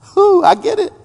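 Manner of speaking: high, relieved whew; relieved Paul. Retold or imitated. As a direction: let the penny drop with a sigh.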